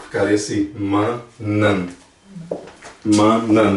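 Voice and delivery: manan said slowly and deliberately; demonstrating manan. Shown as a demonstration. Teacher models manan slowly for students. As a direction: sound out manan, the plural. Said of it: "você pode ver que esse aqui parece".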